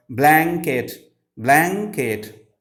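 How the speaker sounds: "Blanket" as two drawn-out beats, blan-ket; teacher splitting "Blanket" into two syllables. Shown as a demonstration. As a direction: stretch each half of "Blanket". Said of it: "Two syllabic words".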